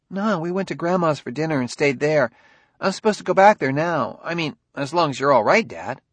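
put-on high boy's voice, explaining; a kid reporting to his dad; young son Ben.

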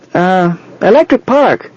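put-on high, slow child voice; high-pitched little-kid voice, drawn out; small boy. A narrator's reading. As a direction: answer like a little boy making up his mind. Small boy makes his choice.

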